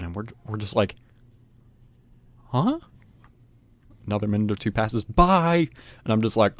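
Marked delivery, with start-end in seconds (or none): 2.5-2.8 high, rising confused question; puzzled. Retold or imitated. Boys reacting to a mystery shout, puzzled. 5.0-5.7 high, sustained mock call; imitated yell. Minutes pass and they call out, mock yell. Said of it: "they yell out".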